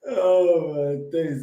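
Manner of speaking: normal talking voice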